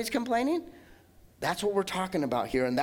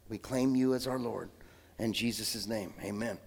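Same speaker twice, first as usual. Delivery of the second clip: softer, lower, steady praying voice; earnest prayer; 6 semitones lower, 4 dB quieter than usual. Acted. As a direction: pray it quietly to the Father. Pastor leads congregation in prayer.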